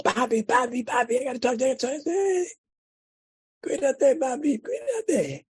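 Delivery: high-pitched imitation of an overjoyed boy; voiced kid, lit up and breathlessly eager; excited son. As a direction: call to Dad, bursting with news. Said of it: "he's lit up like a Christmas tree".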